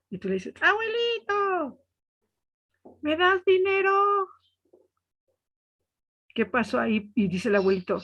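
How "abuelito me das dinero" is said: high, slow call to grandpa; mock shout. Child calling high to deaf grandpa. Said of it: "escuchó que le gritaste".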